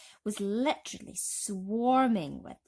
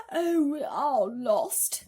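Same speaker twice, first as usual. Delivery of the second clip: loud, higher, very slow lament; crying out; 6 semitones higher, 7 dB louder, much slower than usual. A narrator's reading. Miss Spider crying to all that they are lost. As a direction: despair pouring out. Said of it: "Miss Spider cried".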